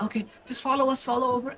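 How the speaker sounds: put-on quick, high-pitched child voice; welcoming child. Child calling the party over, quick and high. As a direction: hurry them along, pitched high. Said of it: "as he goes".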